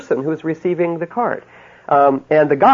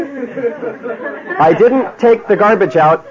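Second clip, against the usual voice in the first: high, slow, puzzled mock husband; bewildered slow-motion husband voice; baffled husband; 6 semitones higher, much slower than usual. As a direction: drag it out like a confused man who can't follow. Husband puzzled by her reaction.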